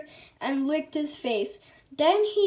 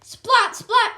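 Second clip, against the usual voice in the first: high, loud splat splat; splat sound effect; 8 semitones higher, 7 dB louder than usual. A narrator's reading. Storyteller making the ketchup splat noise, high and loud.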